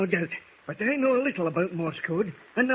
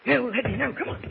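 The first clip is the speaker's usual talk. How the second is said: usual speaking voice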